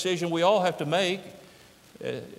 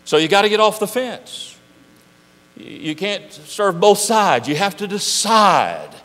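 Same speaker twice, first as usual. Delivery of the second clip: preaching; 7 semitones higher, 7 dB louder than usual. Acted. Preaching at full force.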